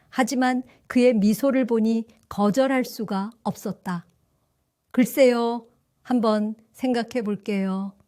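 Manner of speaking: plain reading voice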